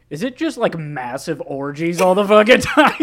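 loud, high, alarmed question; shocked question. Retold or imitated. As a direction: blurt it out in disbelief. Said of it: "I was like".

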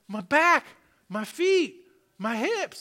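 high, separate exclamations with pauses; imitated amazed exclaiming. Healed woman checking her body, imitated amazed exclaiming. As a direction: call out each one in mock astonishment.

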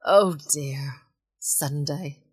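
usual speaking voice

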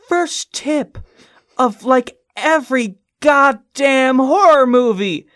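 high, loud, slow exasperated warning; exasperated outburst. Real, not acted. Warning Frank about horror movie rules, exasperated high and loud. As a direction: scold a friend for walking toward the monster.

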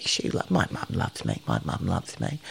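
soft, breathless imitation of a frightened boy; boy on the plane comforting himself, whimpered; mock whimper. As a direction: murmur it to yourself, mock scared. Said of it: "he kept going".

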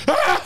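sudden high yelp of fright; mock scared shriek; startled yelp. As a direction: yelp like you've been spooked. Scared man yelps and runs.

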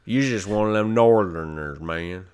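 lightly hammed-up Southern accent, teasing; a hint of a Southern accent, jokey; mock Southern accent. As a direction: play a Southern guy ribbing a Northerner in a Southern accent. Mocking Southern drawl banter.

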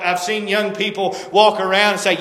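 normal talking voice

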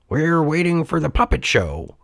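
lightly put-on friendly character; friendly man. A narrator's reading. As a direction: answer as a cheery passer-by. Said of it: "answered a friendly-looking man".

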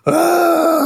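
high-pitched comic wail; drawn-out high cry of a burning man; mock scream. Co-host imitating a burning man falling on fire, mock scream. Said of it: "then he's just like".

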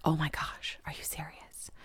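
very soft, voiceless mock-starstruck whisper; hushed awe. Would-be assistant to the hitman on being invited in, hushed awe. Awestruck fan asked to join hit.

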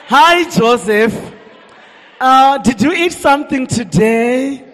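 slow, drawn-out rich-lady English accent; rich people's accent. Shown as a demonstration. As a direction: stretch it like a wealthy woman showing off her rich accent. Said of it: "ndo accent ke vanhu vane mari".

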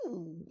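very high falsetto titter into "ooh"; stifled high-pitched glee; giddy giggle. Retold or imitated. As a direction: giggle softly up high, then coo. Retold giddy reaction to shower request.

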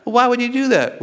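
high-pitched motherly disbelief; acted mom, aghast, pitch jumping up; worked-up mother. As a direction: question your son in rising, incredulous alarm. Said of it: "worked up".

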